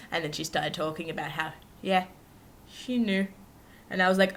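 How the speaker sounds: ordinary talk, nothing marked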